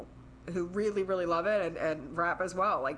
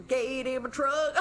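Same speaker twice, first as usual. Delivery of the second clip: slow, higher hammed-up twang; put-on country accent; 5 semitones higher, slower than usual. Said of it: "it's, like, put on".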